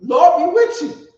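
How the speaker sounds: high, loud exclamation; mock calling out. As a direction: call it out high over the room. Calls out the same phrase, quoted.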